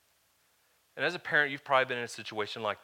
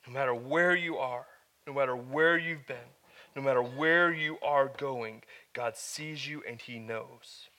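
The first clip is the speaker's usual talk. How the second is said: raised, emphatic sermon delivery; building pulpit preaching, louder than the story parts; 4 semitones higher, 5 dB louder than usual. Acted. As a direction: preach it to the room with conviction. Preaching to the congregation.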